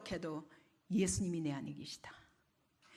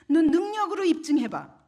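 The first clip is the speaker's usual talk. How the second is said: loud, raised challenge; mocking devil; 7 semitones higher, 10 dB louder than usual. Devil challenges a believer to prove it, mocking loudly. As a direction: throw it down as a taunting dare. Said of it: "마귀는 계속 조롱합니다".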